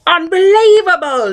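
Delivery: high, loud outburst of disbelief; shouted. A narrator's reading. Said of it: "he shouted".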